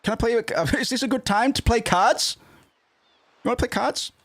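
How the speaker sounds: high, sweet put-on girl's voice; girly character voice. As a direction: pitch way up to play the heroine. Voicing Rinoa in high register.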